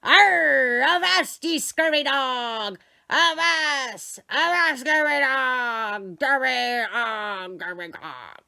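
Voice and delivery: loud, slow pirate bark, fading out; bellowing toy pirate. Acted. Talking pirate toy bellowing at the room, loud slow pirate, winding down. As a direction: growl it like a wind-down pirate toy. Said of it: "pirate that bellowed".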